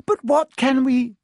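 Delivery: high, loud, anxious question; helpless plea broken off; worried schoolgirl, stopped short; cut-off alarm. A narrator's reading. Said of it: "Hermione gasped".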